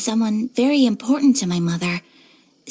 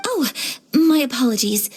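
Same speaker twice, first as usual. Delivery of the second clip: ordinary delivery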